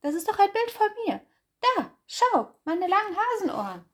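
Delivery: high, quick, loud hare insisting; eager hare voice. Acted. Hase insists the picture shows him, high and fast. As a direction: rattle it off fast in a high little voice.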